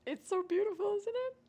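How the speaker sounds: high, gushing over the gift; gushing delight. Real, not acted. Showing off her birthday pot, high gushing delight. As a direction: coo over it, thrilled.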